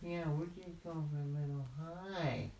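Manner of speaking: high, small kitten voice; little kitty. Acted. Kitty explaining a hike to the bear, high and innocent. Second kitty echoes the answer.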